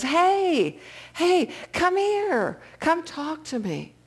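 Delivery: a frail elder calling across the train car; old man calling. Retold or imitated. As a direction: beckon the drunk over in a high old man's voice. Old man calls out to drunk.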